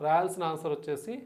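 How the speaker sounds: ordinary delivery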